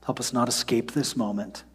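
soft, quiet prayer voice; hushed pastoral prayer; closing prayer. Real, not acted. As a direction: pray gently over the people. Leads congregation in prayer.

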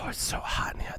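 hushed, unvoiced whisper; breathy whisper. Voicing breathless poisoned Bronn.

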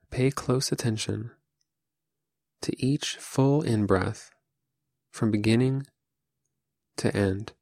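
calm, unhurried meditation voice with long pauses; guided meditation. Acted. Teacher leading listeners through seated Zazen, calm guided meditation. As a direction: guide them gently, phrase by phrase, leaving room to breathe.

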